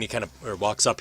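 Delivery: normal talking voice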